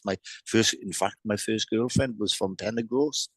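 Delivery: ordinary talk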